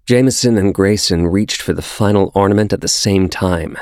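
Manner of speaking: ordinary delivery, nothing marked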